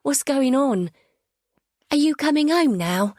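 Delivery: put-on high, eager young boy's voice; a boy pleading to know if mum's coming home; anxious boy. A narrator's reading. Son questioning mother on the phone.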